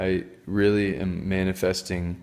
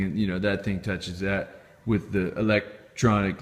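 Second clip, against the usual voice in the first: normal talking voice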